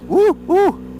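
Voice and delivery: high, excited woo woo. Real, not acted. Rider enjoying the bumps, high gleeful woos. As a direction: whoop with glee. Bumpy descent on bike.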